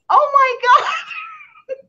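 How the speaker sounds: high, slow laughing cry; laughing and crying at once; laughing shriek. Retold or imitated. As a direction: squeal it through laughter. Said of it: "she's like".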